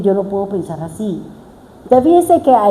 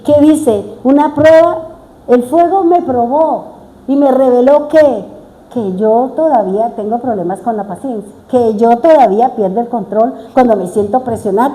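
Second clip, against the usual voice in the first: ordinary talk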